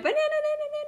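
high squealed banana chant, no real volume; playful, high-pitched pretend scream; mock scream. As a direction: squeal the syllables out in a silly run. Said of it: "now I'm just gonna scream it".

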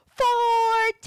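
full-voice shout of "Forte"; big loud shout. Shown as a demonstration. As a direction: shout it with the class. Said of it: "big loud voice".

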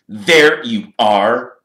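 loud, very slow, stretched greeting; drawl. A narrator's reading. Malfoy finding his two friends at last, drawling. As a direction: draw every word out lazily, a little high. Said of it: "he drawled".

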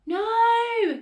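very high, loud drawn-out "no"; panicked, piercingly pitched protest; alarmed cry. A narrator's reading. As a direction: cry out to stop a disaster. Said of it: "cried Missus Cranky".

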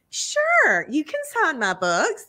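imitating a coy, knowing reply. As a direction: swoop high on the first word, then settle. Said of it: "she was very, like, knowing about it".

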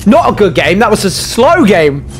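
high, punchy exclamations; animated post-game outburst; worked-up exclaiming. Real, not acted. Exclaiming after losing the game.

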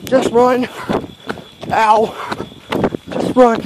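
breathless fleeing panic; panicked running. Real, not acted. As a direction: yell at yourself to run for it.

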